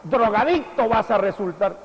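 high, loud, fast rebuke; mock scolding parent. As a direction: rattle off the accusation like a fed-up parent. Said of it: "no le diga". (padre regañón, agudo y rápido)

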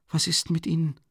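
high, alarmed question; frightened question. A narrator's reading. Sonja startled by his deathly pale face, asks high and alarmed. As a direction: ask in sudden fright.

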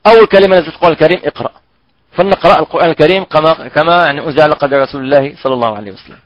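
normal talking voice

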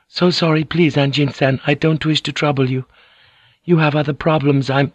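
high, deferential pleading; thin, high voice. A narrator's reading. Fujiko not wishing to trouble Anjin-san, thin high voice. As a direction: beg off politely, voice pitched high. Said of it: "in her thin, high voice".

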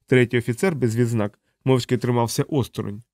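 plain reading voice

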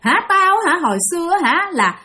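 high-pitched, confrontational ghost woman; menacing demon woman. Retold or imitated. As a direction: challenge a trespasser as an angry demon. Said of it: "Mà bả cứ liên tục bả nói". (giọng bà quỷ hăm dọa, cao gắt)

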